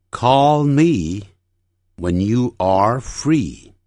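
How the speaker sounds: slow sentence drill with a pause midway; unhurried, separated phrases for repeating; phrase-by-phrase model. Shown as a demonstration. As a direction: model it in careful chunks. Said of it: "整句练习".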